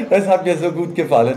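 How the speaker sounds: words carried on laughter; amused and loud; laughing. Real, not acted. Still laughing after joke.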